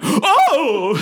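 high, loud gasping shriek; shocked gasp. Retold or imitated. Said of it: "there was an audible gasp and then shriek".

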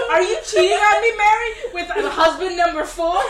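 loud playful accusation; mock accusing shout. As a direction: yell it like a jealous spouse, laughing. Acted quote in a joke scenario.

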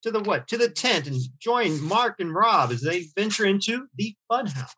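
high, booming circus barker; carnival barker. Acted. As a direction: call the crowd in like a ringmaster. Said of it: "hey, you just did it".

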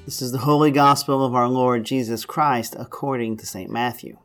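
solemn, measured Gospel reading; scripture reading. Acted. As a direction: read the scripture to the congregation. Reads the Gospel aloud.

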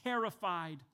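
usual speaking voice

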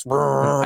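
buzzing power tool imitation; power tool noise. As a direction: lips buzzing like a sander. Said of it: "with, like, fucking power tools".

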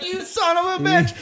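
put-on high, strained drunken cry of outrage; mock outrage. Charging his friend after a punch, mock outraged. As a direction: squeal the insult as if charging in.

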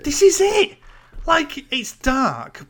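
high exasperated yelp, slowing down. Real, not acted. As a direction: cry out in exasperation. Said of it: "I just".

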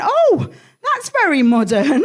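high loud surprised Oh then dry remark; mock compere. Compere reacting to her plain name, mock surprised high oh. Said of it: "He went".

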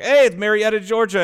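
high, loud burst of recognition; excited exclamation. Retold or imitated. Pointing out a hometown on CNN, excited.

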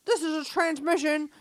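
put-on operator calling the spirits; imitating a medium on the line; telephone operator. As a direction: call out like a radio operator. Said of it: "a telephone operator".

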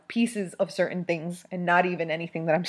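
normal talking voice

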